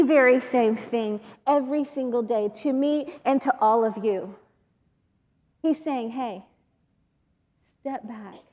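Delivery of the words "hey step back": lower, much softer loving call; a soft voice of Jesus; gentle. Retold or imitated. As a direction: speak it tenderly like Jesus. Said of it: "Not in a Cajun accent".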